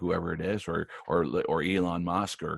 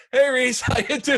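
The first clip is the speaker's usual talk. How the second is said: very high hello called over a crowd; calling across room; 4 dB louder than usual. Retold or imitated. Famous star calling to an old friend across the room, loud hello. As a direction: call out over the entourage. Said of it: "wave over the entourage and go".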